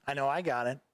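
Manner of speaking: ordinary delivery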